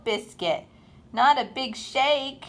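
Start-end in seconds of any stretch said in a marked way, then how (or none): none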